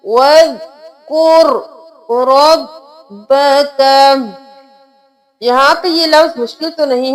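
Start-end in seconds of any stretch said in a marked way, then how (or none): 0.0-4.0 slow, drawn-out tilawat with long vowels; Quranic recitation. Shown as a demonstration. Teacher reciting the verse for students, slow and melodic. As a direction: recite it slowly, stretching each vowel.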